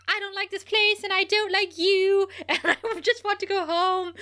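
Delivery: high, mock-petulant complaint; annoying whining. Podcaster imitating confused Buffy freaking out, annoyingly whiny. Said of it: "and it's super annoying".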